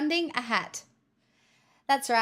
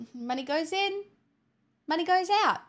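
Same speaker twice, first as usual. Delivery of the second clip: higher sing-song repeat of the slogan; sing-song higher take; 7 semitones higher than usual. Shown as a demonstration. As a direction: chant it higher and brighter the second time.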